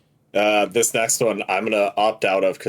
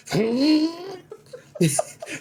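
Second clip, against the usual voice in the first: high, sputtering gag-laugh; high choking laugh; 3 dB quieter than usual. Retold or imitated. Retelling swallowing the meat, high choking laugh. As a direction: reenact gagging into a laugh. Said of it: "and I went".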